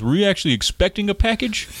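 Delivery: a hint of a puzzled postal worker; doubtful clerk.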